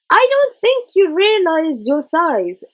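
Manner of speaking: high, loud and slow, a sharp retort; tart retort. A narrator's reading. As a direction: pointedly tell the elephant off. Chulak retorts to Hamlet.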